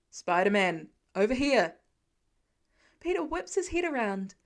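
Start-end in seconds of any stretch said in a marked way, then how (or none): 0.1-1.8 louder call across the gap. Acted. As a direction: hail him from the ladder. Firefighter calling from ladder.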